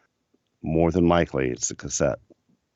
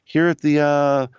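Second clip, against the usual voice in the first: higher, cheerful Tony voice; imitating Tony; 8 semitones higher than usual. Tony telling Ryan about flying to Hawaii, higher and cheerful. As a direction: play the cheerful traveller. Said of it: "He goes".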